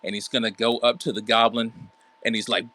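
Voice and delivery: ordinary talk, nothing marked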